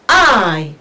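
I drawn out as "aaiy", falling; diphthong demo. Shown as a demonstration. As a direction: slide I slowly from ah into ee. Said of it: "we pronounce it as".

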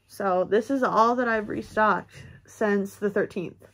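ordinary talk, nothing marked

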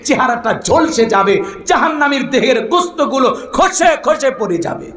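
high-pitched, emphatic sermon delivery; impassioned preaching. Acted. Preacher warning what hell's water does, impassioned preaching. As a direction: drive the warning home with swooping pitch.

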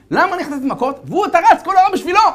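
high, loud, fast protest; indignant complaint. Acted. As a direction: whine the unfairness hard. Son complains, jealous.

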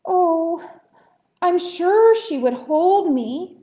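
high, loud, slow little-bird plea; lightly hammed-up tearful longing; mock sobbing. A narrator's reading. Little bird tells bear of dream mother, sobbing lightly. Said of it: "sobbed Choco".

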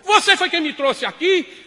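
loud, high, slow shout; shouted question. Acted. Wife shouting at husband in cabaret, loud high. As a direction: fling the question back.

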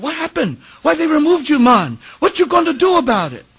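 loud, high Bajan agitator; hammed-up Barbadian accent, pitched high and loud; attempted Barbadian accent. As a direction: stir up trouble in a put-on island accent. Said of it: "my attempt to do an island accent".

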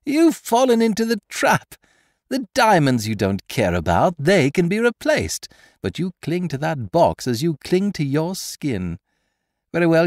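high, loud, gleeful taunt; gloating Lupin. Acted. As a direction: crow over the caught victim.

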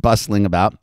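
plain reading voice

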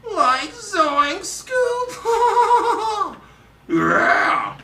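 very high, loud cartoon stoner yelp; zany high-pitched cartoon sidekick; Shaggy from Scooby-Doo. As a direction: stretch it out in a loud cracking cartoon voice. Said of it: "How about Shaggy from Scooby-Doo?".

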